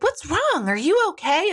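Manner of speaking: high-pitched mock nurse concern; imitated nurse. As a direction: play a fussing nurse, pitched well up. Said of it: "the nurse was like".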